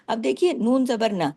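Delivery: ordinary talk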